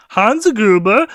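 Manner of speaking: "Hans Gruber" in a hammed-up Swedish accent, rising at the end; mock Swedish accent. Retelling Sharky's Hans Gruber disguise line, mock Swedish accent. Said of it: "in a Swedish accent".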